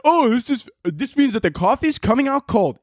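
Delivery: high, dopey voice of a fooled buyer; mock idiot voice. Vlogger mocking gullible Keurig buyers, dopey high voice. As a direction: play the gullible customer falling for the button. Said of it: "makes other idiots think that".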